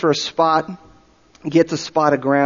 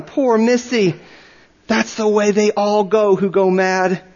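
pitying villagers; 5 semitones higher, slower than usual. Acted. Villagers pitying the mad missionary.

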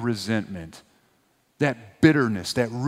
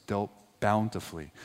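usual speaking voice

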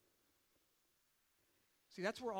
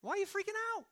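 high mock shout of an amused dad; dad calling out; 12 semitones higher, much faster than usual. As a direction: call out high across the pool. Dad shouting at kid in pool.

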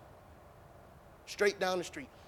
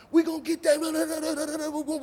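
imitating kids yelling; 9 semitones higher, 4 dB louder than usual. Kids yelling as they chase.

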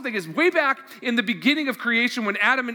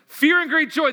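excited exclamation; 8 semitones higher, 6 dB louder than usual. Acted. Preacher to congregation, exclaiming the women's joy, excited. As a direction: burst out with "fear and great joy", higher and louder.

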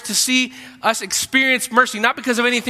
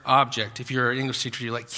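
ordinary talk

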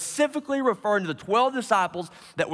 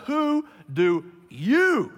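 slow build, then land hard on "you"; "you" stretched long and punched; stress on YOU; 5 semitones higher, much slower than usual. Shown as a demonstration. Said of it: "notice this emphasis on the word you".

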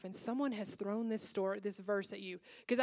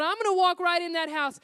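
determined inner vow; 7 semitones higher, 7 dB louder than usual. Retold or imitated. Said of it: "and I thought".

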